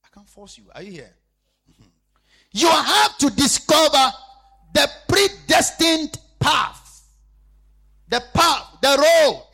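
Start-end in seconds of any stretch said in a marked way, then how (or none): 2.5-9.4 loud, slow, high preaching cry; loud pulpit cry, drawn out; preaching shout. Acted. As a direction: proclaim it at full force. Preaching at full force.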